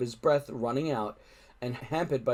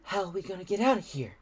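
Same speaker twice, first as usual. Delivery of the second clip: fast, higher anxious question; suppressed panic; 6 semitones higher, faster than usual. Acted. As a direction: rush it out, fighting panic. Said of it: "trying not to panic".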